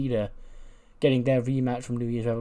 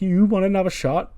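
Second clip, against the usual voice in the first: high, louder incredulous question; scoffing champion, pitched up; mocking disbelief; 8 semitones higher, 7 dB louder than usual. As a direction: throw the request back scornfully. Bayley mocks Liv's request.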